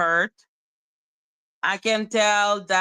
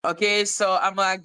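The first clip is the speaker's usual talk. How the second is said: normal talking voice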